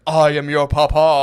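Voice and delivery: loud, slow, booming patriarch; Lion King sky voice. Retold or imitated. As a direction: boom it out like a father in the heavens, hammed-up. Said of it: "sounds like the sky from the Lion King".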